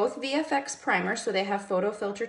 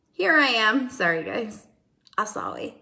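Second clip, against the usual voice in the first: normal talking voice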